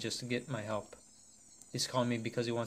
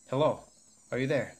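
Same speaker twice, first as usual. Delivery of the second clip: calling out urgently; 2 semitones higher, 6 dB louder than usual. Acted. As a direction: call him back before he's gone. Caller stops answering, detective calls out.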